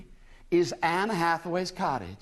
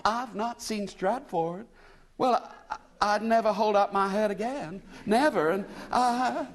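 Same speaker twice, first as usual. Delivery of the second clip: higher, lost American woman near tears; tearful; 7 semitones higher than usual. A narrator's reading. American lady telling William she is lost, tearful. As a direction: plead on the edge of a sob. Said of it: "she ended in a sob".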